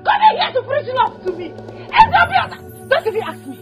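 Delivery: sobbing, shouting fury; woman screaming through tears at the man who wronged her; crying shout. Acted. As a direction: wail it out at him through tears. Woman shouts and cries at suitor.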